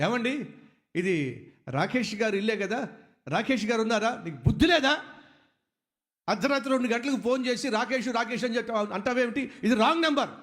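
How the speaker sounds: angry. Retold or imitated. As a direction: scold the late caller with real heat. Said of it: "కోపం".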